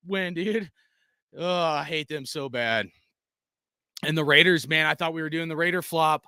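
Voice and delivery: ordinary talk, nothing marked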